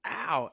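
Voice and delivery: sharp mock ow of pain; retold cry of pain; yelp of pain. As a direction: yelp like you've been whacked. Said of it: "and he says".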